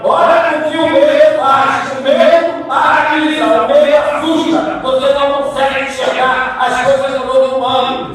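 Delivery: high, forceful exhortation; impassioned preaching. Real, not acted. Preacher warns listeners what fear does, impassioned exhortation. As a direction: press the warning on the congregation with fervour.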